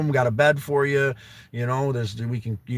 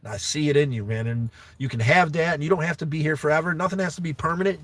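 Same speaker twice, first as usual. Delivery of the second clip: ordinary talk, nothing marked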